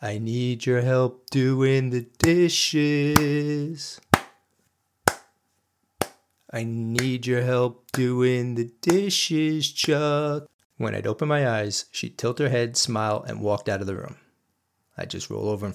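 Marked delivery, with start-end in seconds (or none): 0.0-10.5 slow, sing-song nagging voice; mock nagging wife, drawn out; imitating wife. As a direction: drag the words out like a nagging wife.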